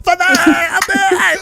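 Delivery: high, slow mock wail; hammed-up daughter's cry. Daughter zapped by lightning calls Father, high mock wail. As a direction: draw it out in a melodramatic shriek.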